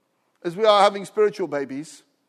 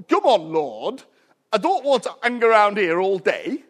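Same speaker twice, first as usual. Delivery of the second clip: put-on Yorkshire accent; 4 dB louder than usual. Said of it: "That's how they speak".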